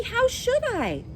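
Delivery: put-on high, slow child's voice; high innocent question. Dorothy asks the witch, high and slow. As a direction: ask it with wide-eyed innocence. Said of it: "answered Dorothy".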